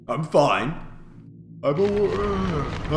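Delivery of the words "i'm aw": fake yawn. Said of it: "that was a fake yawn".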